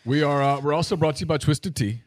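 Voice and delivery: upbeat sponsor read. Acted. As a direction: sell it like a radio ad. Reads a sponsor ad.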